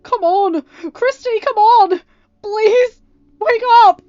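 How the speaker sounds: high, loud, crying plea, slowed; sobbing pleading shout. Acted. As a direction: beg her through tears to wake. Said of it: "Ryan urged".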